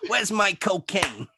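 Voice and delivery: sleazy, high, drawn-out showman; Stan Lee impression. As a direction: stretch it out like a seedy old celebrity. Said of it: "I make it sound like sleazy Stan Lee".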